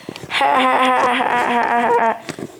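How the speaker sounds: long, high put-on laugh; mock uproarious laughter; acted group laugh. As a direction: cackle along as a whole crowd of engines. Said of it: "They all laughed".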